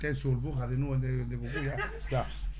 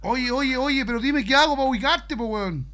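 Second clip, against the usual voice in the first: loud; 4 semitones higher, 6 dB louder than usual. Acted. Calling out before friend hangs up.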